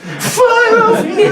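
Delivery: very high, loud, slow blubbering; hammed-up drunken wail through snot; snotty drunk sobbing. Drunk wife sobbing love at husband, loud and high. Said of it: "about the snot".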